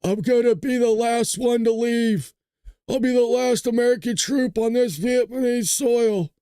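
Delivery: hammed-up movie soldier speech; Mel Gibson movie hero. Mocking Huckabee as a war movie hero, heroic vow. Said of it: "he's Mel Gibson from We Are Soldiers".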